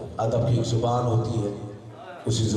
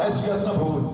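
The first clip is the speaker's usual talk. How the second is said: ordinary talk, nothing marked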